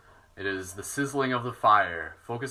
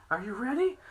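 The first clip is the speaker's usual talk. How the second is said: much higher rising puppy voice; 12 semitones higher than usual. Real, not acted. Owner asking her dog if he's ready in baby talk. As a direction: coo the question at the dog.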